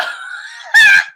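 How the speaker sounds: loud high shriek of laughter. Real, not acted. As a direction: shriek with laughter, full force. Cracks up at got hands.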